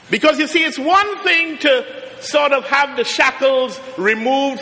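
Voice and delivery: rising, measured sermon cadence with long pauses; preaching. Acted. Formal sermon opening, preaching.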